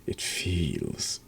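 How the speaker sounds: soft, near-whispered reading of game lines; hushed reading. Acted. Player reading the game's text in a hushed whisper. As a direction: breathe the words out quietly, slowed.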